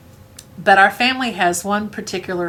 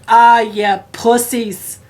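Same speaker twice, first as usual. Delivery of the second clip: louder, slow mocking jeer; gruff taunting grandpa; 4 semitones higher, 6 dB louder, much slower than usual. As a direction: sneer at them like a tough old hunter. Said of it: "my grandpa says".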